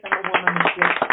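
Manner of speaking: proud loud woman of grace; proud declaration. Shown as a demonstration. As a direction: proclaim it like a banner.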